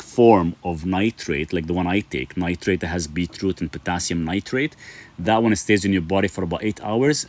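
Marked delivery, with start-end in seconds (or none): none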